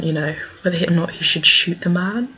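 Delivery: normal talking voice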